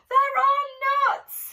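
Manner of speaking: high, loud, slow exclamation. Acted. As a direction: call out in despair as the nuts vanish. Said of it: "exclaimed Bruce".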